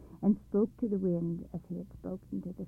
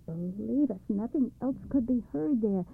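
plain reading voice

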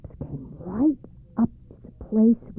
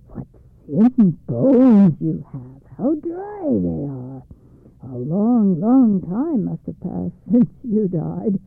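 slow, loud, relishing ghoul; gloating evil spirit; 7 dB louder, slower than usual. A narrator's reading. Evil spirit marvels at the skeleton's dry bones, slow and gloating. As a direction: savor every word like a greedy ghost.

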